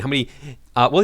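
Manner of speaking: ordinary talk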